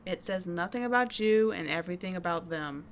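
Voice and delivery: ordinary talk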